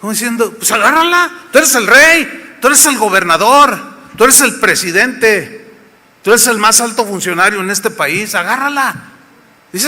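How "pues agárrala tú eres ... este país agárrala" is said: high, loud, insistent push; pushy urging. Acted. Wife urges the king to just take it, loud and high. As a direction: egg him on, louder each time.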